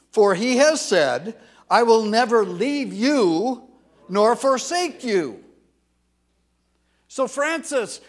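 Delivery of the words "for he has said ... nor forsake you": loud, slow scripture reading; reading scripture aloud. Shown as a demonstration. Preacher reading Hebrews aloud with the congregation, loud and slow. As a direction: declaim the promise slowly. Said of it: "Let's continue reading".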